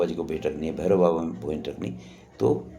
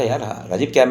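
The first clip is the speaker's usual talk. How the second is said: louder, higher mock scolding of a friend; scolding a colleague; 5 semitones higher, 7 dB louder than usual. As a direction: tell Rajeev off, raised and impatient. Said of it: "मैंने हाथी को डांटा". (डांटते हुए, ऊँची तेज़ आवाज़ में)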